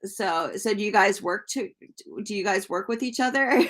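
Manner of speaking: ordinary delivery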